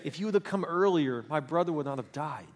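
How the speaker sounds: usual speaking voice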